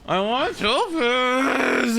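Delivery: slow, high whiny want; childish whine. Acted. Streamer begging the game for Topaz, childish whine. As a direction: stretch it like a kid begging. Whining for a favourite character.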